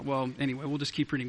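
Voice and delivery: ordinary talk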